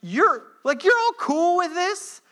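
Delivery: high, disbelieving question; incredulous question. Retold or imitated. Paul asking the church if they accept this, incredulous. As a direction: ask it in shock. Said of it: "Paul's just being like".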